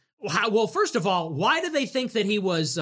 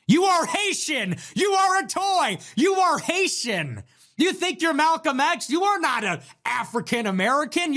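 heated rant; 7 semitones higher than usual. Real, not acted. Firing back at Sneeko for calling him a larper, heated high rant. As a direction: pile on the insults, voice up high.

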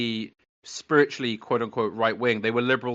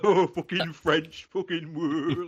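slow, higher mock-French noises; 4 semitones higher, 4 dB quieter, slower than usual. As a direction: caricature French sounds with disdain. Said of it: "like all that, you know".

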